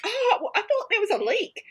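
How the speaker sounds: high, relieved mock voice; imitating relieved roommate. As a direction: sound sheepish about the false alarm.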